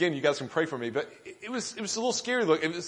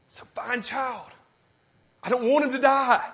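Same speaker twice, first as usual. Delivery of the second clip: tender mother; 7 semitones higher than usual. Retold or imitated. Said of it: "What Hebrew mother ... and go".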